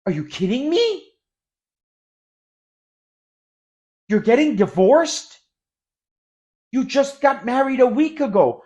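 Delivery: high, loud astonished disbelief. Shown as a demonstration. Teacher showing astonishment at quick divorce news, loud disbelief. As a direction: sound like you can't believe the news.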